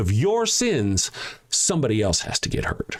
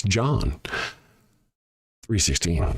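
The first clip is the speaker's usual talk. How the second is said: slower; 5 semitones lower than usual. Shown as a demonstration. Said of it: "I'll say it again slower".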